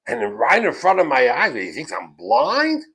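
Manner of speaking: loud, incredulous outburst; indignant outrage. Retold or imitated. Rebbe fuming over a pupil cheating, indignant.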